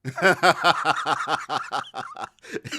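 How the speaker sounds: big burst of laughter; loud laugh. Real, not acted. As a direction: crack up loudly. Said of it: "Yes, [name]".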